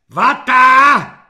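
high, very loud jeering yell; mocking shout. A narrator's reading. Corporal mocking the recruit's answer, jeering shout. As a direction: yell it back in scorn. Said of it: "vykřikl Halík posměšně".

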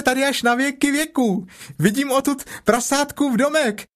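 very high excited joey voice; a delighted little kangaroo babbling; chattering Roo. A narrator's reading. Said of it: "Roo chatters from the tree". (nadšeně štěbetající klokánek)

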